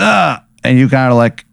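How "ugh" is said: morning groan. Acted. Waking groan.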